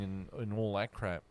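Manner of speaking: ordinary delivery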